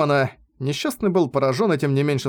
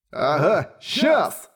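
mocking laugh; 4 semitones higher, much slower than usual. A narrator's reading. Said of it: "рассмеялся Дюгалд".

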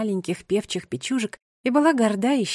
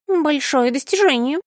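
higher, louder eager bird voice; excited pigeon voice; 6 semitones higher, 5 dB louder than usual. Acted. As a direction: chime in with praise. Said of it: "подхватил второй". (восторженный голос голубя)

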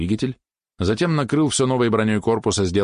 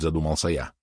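ordinary delivery, nothing marked

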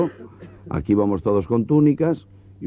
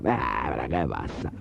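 stoned drawl; 9 semitones lower, 7 dB quieter, slower than usual. Acted. Stoned legionnaire offering a joint.

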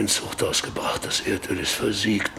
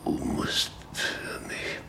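pleading whisper; 5 dB quieter than usual. Acted. Dying father whispers to his daughter Britta for prayer. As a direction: beg softly with the last breath.

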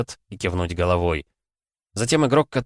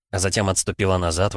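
plain reading voice

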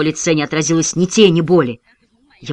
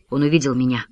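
ordinary delivery

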